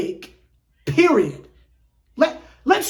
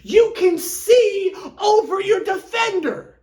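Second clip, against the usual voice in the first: exasperated coaching shout; 9 semitones higher than usual. Real, not acted.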